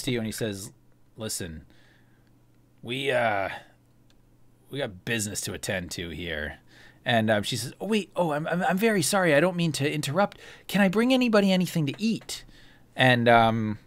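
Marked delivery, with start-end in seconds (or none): 1.2-6.6 low, soft, wary gambler; lower, quieter gambler sizing up a rival; Ander voice. Acted. 7.8-12.5 a touch higher, eager waitress; lightly voiced fussing server; eager serving girl fussing over guests.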